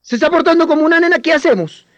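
put-on high, loud, taunting rant at a boy; mock and humiliate, fast and high-pitched; mocking scolding. Adults shaming a little boy for crying, loud high mocking scold. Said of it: "nos dicen desde pequeños".

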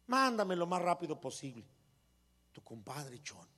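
wife reads the same letter gently; gentle reading. Acted. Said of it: "así por las buenas".

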